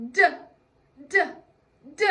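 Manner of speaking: "d" as a short "duh", not the letter name; three punched, separate "d" sounds; letter-sound demo. Shown as a demonstration. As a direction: say "d" as a quick isolated consonant, pausing between. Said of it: "and he says".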